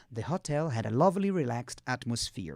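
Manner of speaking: whole sentence linked, slowing low and soft at the end; joined-up model reading. Shown as a demonstration. Teacher giving the whole sentence before students repeat, joined-up and measured. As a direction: run the chunks together, still careful. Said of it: "Pega devagarzinho".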